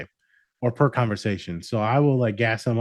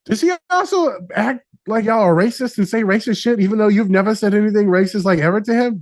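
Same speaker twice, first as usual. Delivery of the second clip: high, loud mock-serious question; client's question; 10 semitones higher, 6 dB louder than usual. Client asks the group about Nas, high loud teasing. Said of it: "the one girl goes".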